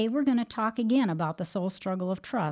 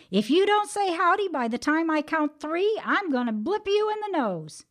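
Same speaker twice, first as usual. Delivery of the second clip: high, loud angry threat; threatening; 10 semitones higher, 4 dB louder than usual. A narrator's reading. Brer Rabbit threatening the tar baby with a punch, high and loud. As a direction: warn it, fist clenched.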